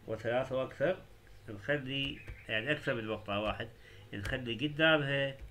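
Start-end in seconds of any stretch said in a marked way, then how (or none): none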